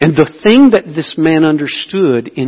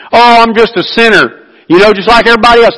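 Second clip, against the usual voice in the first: high, loud, fast offhand shrug; mock glib excuse; 9 semitones higher, 9 dB louder, much faster than usual. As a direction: toss it off like a cheap excuse. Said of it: "that's easy for us".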